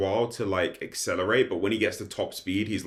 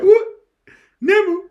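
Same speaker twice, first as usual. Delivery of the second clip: squeaky, much higher panicked yelp; mock panic; 13 dB louder than usual. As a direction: crumble under pressure in a high squeal. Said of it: "he's just like".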